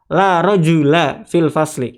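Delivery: "La rajula fil fasli" spoken slowly and clearly; slow model sentence. Shown as a demonstration. Teacher models the Arabic answer slowly for students. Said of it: "Kita ngomongnya gitu".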